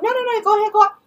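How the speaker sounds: high mimicry of waving off help; high-pitched, dismissive imitation; mock dismissal. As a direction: cut them off in a high mocking voice. Mimicking woman refusing help.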